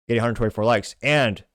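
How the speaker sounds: normal talking voice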